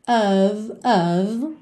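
"of" ending in a held v sound. Shown as a demonstration. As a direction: slowly say "of" as "uhv". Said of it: "sempre com esse som de".

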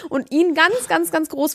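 high, rising pitch on ganz, ganz; high emphatic contrast. Shown as a demonstration. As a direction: push the voice up on each ganz. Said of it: "ganz klein".

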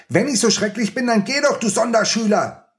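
put-on very high furious boy's yell; Oskar bellowing. Oskar screaming at Rico to leave, very high furious yell. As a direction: scream it at your friend in rage. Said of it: "brüllte Oskar".

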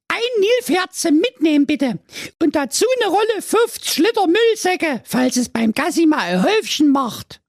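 put-on high, pleading girl voice; whiny teenage daughter. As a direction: play the spoiled fairy-tale daughter wishing aloud. Daughter orders in shop.

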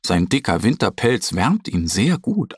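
plain reading voice

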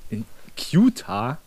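slow "Qatar" said as "kyu-tar"; echoing the mispronunciation. Retold or imitated. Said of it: "Wie er die Länder ausspricht".

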